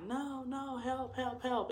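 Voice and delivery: high panicked pleading calls; frantic cry for help. Retold or imitated. Retelling how she begged onlookers for help, high panicked calls.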